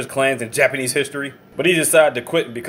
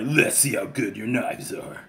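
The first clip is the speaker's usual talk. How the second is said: low, soft, menacing yakuza murmur; quietly threatening, knife in hand; Tatsu's menacing voice; 4 semitones lower, 6 dB quieter, slower than usual. Retold or imitated. Ex-yakuza to a salesman, low and menacing.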